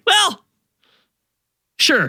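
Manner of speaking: high, sheepish well, sure; hammed-up meek surrender; meek mock agreement. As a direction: cave in sheepishly. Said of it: "And we're like".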